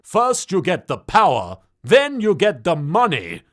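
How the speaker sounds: a touch of a mobster drawl, slow at the end; hint of a Scarface impression; Scarface-style gangster cat. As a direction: lay out the plan like a crime boss. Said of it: "Just call me Pra Face".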